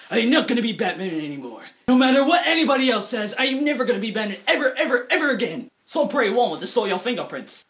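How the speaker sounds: low growled Batman vow; gruff Bruce Wayne. Retold or imitated. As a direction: growl it like a brooding hero. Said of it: "So Bruce Wayne is like".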